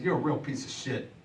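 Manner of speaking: muttering at himself in a low voice. Retold or imitated. Hungover man scolding himself in the morning, low and soft. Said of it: "I said".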